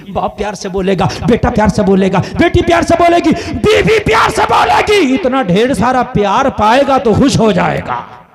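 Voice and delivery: loud list climbing high, then dropping back at full volume; building preaching shout. Real, not acted. Preacher listing a loving family to the crowd, building to a shout. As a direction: build each item to a high cry.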